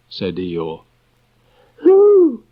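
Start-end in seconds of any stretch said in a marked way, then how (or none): 1.8-2.4 very high and loud eager squeak; bursting with eager curiosity; a small child's high, loud excited cry. A narrator's reading. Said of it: "said Roo excitedly".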